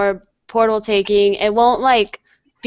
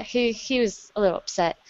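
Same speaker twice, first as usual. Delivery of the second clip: normal talking voice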